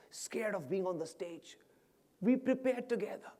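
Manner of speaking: high voice of a tearful woman; imitated emotional retelling. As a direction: act her reliving a proud memory. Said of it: "she's crying and talking, crying and talking".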